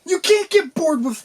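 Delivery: very high-pitched squeal of objection; high, incredulous squawk; outraged protest. Real, not acted. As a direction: protest way up at the top of your range. Protests co-host getting bored.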